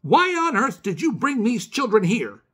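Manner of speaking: high angry shout at the visitors. Acted. Old neighbour shouting at the children, high and loud. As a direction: bark it out, outraged. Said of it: "shouted Miss Whitaker".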